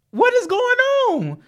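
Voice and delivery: very high, slow, stretched question; baffled, up in the air; disbelief. Real, not acted. Disbelief at the threat.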